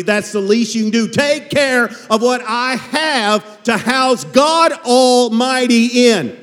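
high, slow, loud sarcastic voice; mock God's grudging demand, dragged out; sneering, drawn-out reproach. Shown as a demonstration. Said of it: "It means sarcastically".

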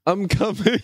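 high-pitched, laughing call; comic yell into the wilds; mock yell. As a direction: call it out high, barely holding back laughter. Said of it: "Poe just yells into, into the nature".

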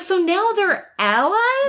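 high drawn-out disbelief; incredulous. Retold or imitated. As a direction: ask it in slow disbelief. Mock disbelieving question.

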